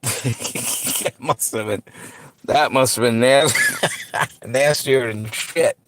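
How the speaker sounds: real laughter breaking up the words; laughing. Real, not acted. Reacting to how nasty it must have been, laughing. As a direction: talk through laughter, slowly getting it out. Cracking up at burnt food.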